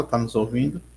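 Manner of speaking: usual speaking voice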